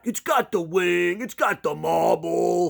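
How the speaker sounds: put-on loud, slow, overdone New York accent; mock a bad New York accent, high and drawn out; attempted New York accent. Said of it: "they try to do the New York accent".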